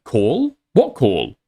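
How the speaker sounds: high, baffled questions, playing dumb; mock confused Aiden. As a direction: feign total confusion about the call. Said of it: "being like".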